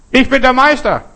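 high, loud boastful shout; calling out. Acted. Telling listeners what to call out, loud high shout. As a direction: shout it out into the mountains.

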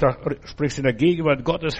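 ordinary delivery

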